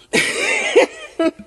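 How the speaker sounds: loud high laugh bursts out; high burst of laughter. Real, not acted. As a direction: crack up at the idea. Laughing at her own refusal.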